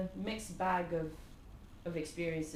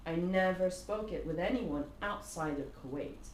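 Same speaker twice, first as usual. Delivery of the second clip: usual speaking voice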